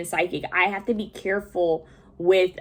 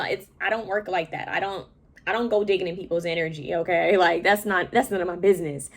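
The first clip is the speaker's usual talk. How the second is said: ordinary delivery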